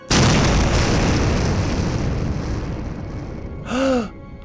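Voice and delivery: long high gasp. Acted. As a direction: gasp in the fall. Hurled into emptiness.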